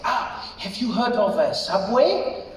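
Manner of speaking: put-on slow German-accented suggestion; Rolf. German friend revealing the lunch spot, German accent. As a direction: draw it out proudly in a German accent.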